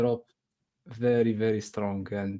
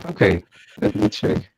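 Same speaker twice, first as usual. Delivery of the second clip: glitchy stream audio like a supervillain; distorted villain voice; 4 semitones lower, 5 dB louder than usual. Real, not acted. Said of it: "Yeah, you sound like Thanos".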